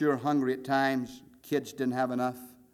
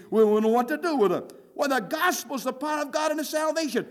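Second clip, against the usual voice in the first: usual speaking voice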